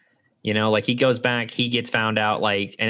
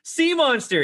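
shrill accusing shout; slower than usual. Retold or imitated. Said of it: "when Luca goes".

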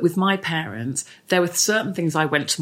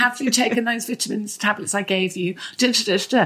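higher-pitched fussy check-up; mumming; 6 semitones higher than usual. Acted. Mum checking on her son's vitamins, playfully fussing. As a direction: play the mum keeping tabs. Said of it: "Mumming, mumming, mumming, mumming".

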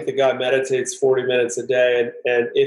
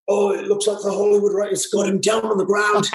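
higher, whispery Scottish golf commentary; golf commentator; 6 semitones higher than usual. Retold or imitated. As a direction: call the fight like a hushed golf announcer. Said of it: "whispery golf voice".

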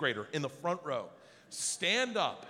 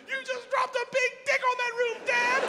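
put-on high, loud thrilled child; excited kid voice; 4 dB louder than usual. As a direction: squeal it like an overjoyed kid.

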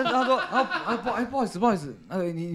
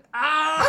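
跪下来的高声惨叫; 痛到崩溃的一声长叫; 痛苦大叫; 13 semitones higher than usual. Retold or imitated. 跪在医生面前痛到大叫. (模仿牙痛时的高声惨叫)

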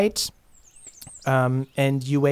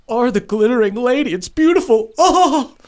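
choking sob; 8 semitones higher, 4 dB louder than usual. Acted. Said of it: "lets out another choking sob".

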